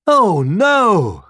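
high, loud wail of dismay; crying out. A narrator's reading. Deer hearing the hares' news, crying out. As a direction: cry out in alarm. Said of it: "cried the deer".

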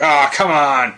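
lightly voiced exasperated friend; whiny complaint. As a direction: toss off a mild whiny protest. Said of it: "I forgot your nasal voice".

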